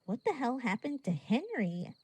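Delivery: ordinary delivery, nothing marked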